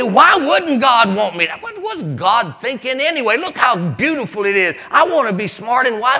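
high, insistent, mounting desire; indignant, wanting Eve. Acted. Eve reasoning toward the forbidden fruit, high and insistent. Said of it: "She said".

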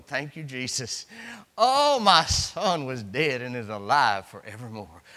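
low-pitched acted thanksgiving; grateful mother. Mother getting her baby back, low grateful praise. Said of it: "Oh, she's going".

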